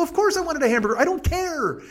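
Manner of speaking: high-pitched, defensive protest; exasperated retort. Retold or imitated. Answering girlfriend's hamburger complaint, exasperated retort. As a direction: push the pitch up in mock exasperation.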